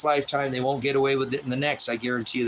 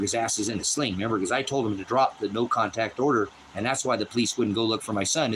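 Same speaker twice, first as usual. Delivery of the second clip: usual speaking voice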